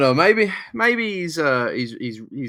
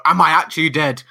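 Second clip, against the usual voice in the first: loud, higher-pitched comic panic; mock panic; 5 semitones higher, 10 dB louder than usual. Joking as Aunt May wondering if she died, mock panic. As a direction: exaggerate a panicking aunt in a raised voice. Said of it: "Aunt May just starts panicking".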